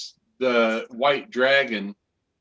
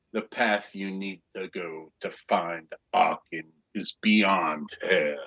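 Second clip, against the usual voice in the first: low, quiet voice from behind; hushed, low turtle creature voice; turtle guide; 5 semitones lower, 5 dB quieter than usual. Acted. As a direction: speak low and soft, pointing the way. Turtle speaks from behind the player.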